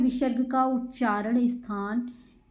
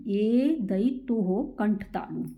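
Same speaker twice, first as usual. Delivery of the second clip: slow rule recital; slower than usual. Shown as a demonstration. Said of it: "teacher slows rule for pupils".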